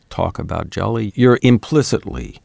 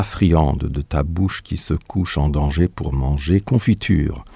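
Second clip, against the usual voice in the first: poem recitation; 2 semitones lower than usual. Acted. Poet reciting Marot's French poem to the radio audience, lilting recital. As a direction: recite it like a cherished little poem.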